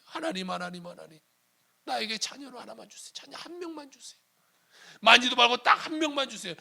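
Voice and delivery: high-pitched acted prayer, begging God; crying-out plea. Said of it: "그냥 울부짖는 거예요". (울부짖듯 간절하게 기도하는 흉내)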